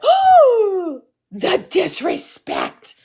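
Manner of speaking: high gasp then slow appalled drawl; mock outrage. Imitating Southern strangers, slow mock-appalled outrage. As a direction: clutch your pearls. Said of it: "So people were like".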